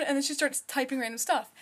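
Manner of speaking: normal talking voice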